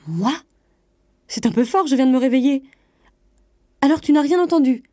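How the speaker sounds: wife's offended outburst; indignant protest. A narrator's reading. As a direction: protest loudly and press the question. Wife protests in bedroom quarrel.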